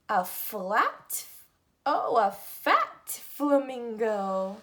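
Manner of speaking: ordinary talk, nothing marked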